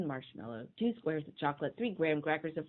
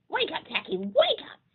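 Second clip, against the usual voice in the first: much higher, loud urgent call; frantic high wake-up cry; cried out; 7 dB louder than usual. Acted. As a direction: shout him awake in a panic. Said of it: "they cried".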